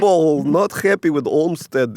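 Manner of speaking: slow, hammed-up Russian accent; a slow, lightly faked Russian accent; mock Russian accent. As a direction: put on a jokey Russian accent. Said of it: "like, a Russian accent".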